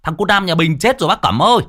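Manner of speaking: high, breathless panicked village woman; flustered woman calling. Acted. Mai bursts in calling to old Cẩm in a panic. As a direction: rush in crying out the bad news. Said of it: "có tiếng người hớt hải chạy vào".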